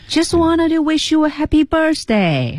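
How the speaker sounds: "Just wanted to wish you a happy birthday" held high throughout; high, sustained pitch up to "birthday". Shown as a demonstration. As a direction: keep the whole "happy birthday" line lifted. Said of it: "它好像整体是扬上去的".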